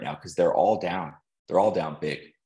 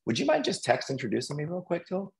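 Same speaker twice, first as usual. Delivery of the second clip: higher, fast, light request to a friend; polite quick ask; 9 semitones higher, 4 dB quieter, faster than usual. Retold or imitated. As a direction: rattle off the favor sweetly. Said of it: "And I'm like".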